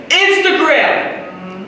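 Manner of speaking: slow, high shout; shouted punchline. Acted. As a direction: drag the word out and shout it.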